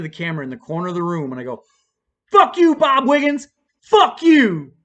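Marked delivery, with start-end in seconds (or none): 2.3-4.7 high, loud shouted curse; re-enacted angry yell; defiant shout. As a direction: yell it straight at someone you're furious with. Acted yelling at the camera.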